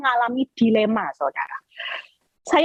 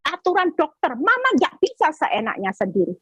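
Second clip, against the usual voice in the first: much higher voice, lecturing and bossy; bossy son; 8 semitones higher than usual. Retold or imitated. Son lecturing his mother on science, high bossy voice. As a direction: play the know-it-all son laying down rules.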